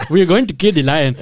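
put-on laughing through a West African accent. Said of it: "the African accent".

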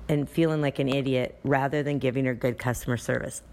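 ordinary talk

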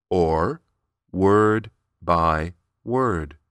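slowed right down, word by word. Shown as a demonstration. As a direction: drag each word out. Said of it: "the varieties of rhythm I've been using".

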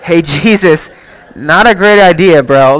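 loud, jokey protest to a friend; mock-indignant loud bro voice; mock objection. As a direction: push back like a doubting teammate. Mock teammate objecting to pick.

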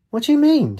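very high-pitched retort; indignant disbelief. A narrator's reading. Katie to Rafe, high indignant question. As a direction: shoot the pitch right up in protest.

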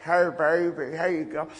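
a lightly voiced old woman's thick Australian accent; imitated thick accent. Preacher imitating the hundred-and-two-year-old woman greeting him in her Australian accent. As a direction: mimic the old lady's broad Australian greeting. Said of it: "She had this thick accent".

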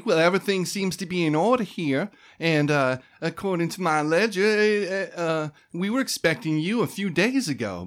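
high, sing-song receptionist voice; chirpy clerk. Acted. Debbie registering new arrivals, chirpy and high. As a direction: run through the forms like a cheerful desk clerk.

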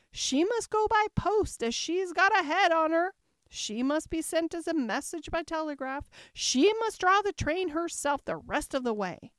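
high, loud excited passenger; chorus of voices. Acted. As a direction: rattle it off like a crowd. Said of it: "other voices went on".

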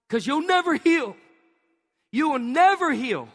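high, loud, emphatic preaching; preaching at full force. Acted. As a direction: hammer the warning home from the pulpit.

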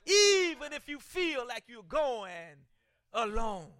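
loud, ringing pulpit proclamation, shouting the big lines; preaching at full force. Acted. Preacher urging his congregation to obey God, booming sermon voice. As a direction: thunder it out like a revival preacher.

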